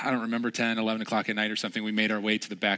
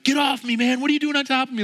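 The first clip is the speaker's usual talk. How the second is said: much higher, louder protest; retold annoyed yell; 7 dB louder, faster than usual. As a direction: shove off a guy who fell on you. Guys piling up in dark.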